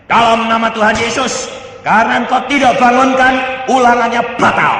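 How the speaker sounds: high, loud commanding prayer; declarative prayer. Acted. Child prays the test is cancelled, loud declarative prayer. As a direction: proclaim it in prayer at full force. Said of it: "sembahyang dia".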